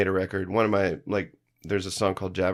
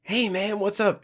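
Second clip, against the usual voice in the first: much higher, breezy greeting voice; imitating the famous singer; 11 semitones higher than usual. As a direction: do him greeting a fan, high and easy. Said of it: "he was like".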